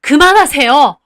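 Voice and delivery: loud cry to stop; shouting stop. Acted. Mother shouts to stop grandma hitting her daughter, loud. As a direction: yell it to break up the fight. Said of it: "소리를 지르셨어요".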